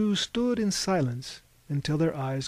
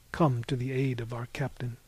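ordinary delivery

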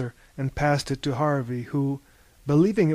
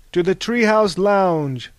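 louder, raised toasting voice; group toast; 4 semitones higher, 6 dB louder, slower than usual. A narrator's reading. The group echoes the toast to the lounge. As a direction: raise your glass and call it. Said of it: "said the group".

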